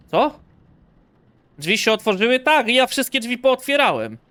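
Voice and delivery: loud, high-pitched exasperation; exasperated outburst. Pretend. Player fed up with the haunted doors, exasperated outburst. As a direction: raise your voice in protest.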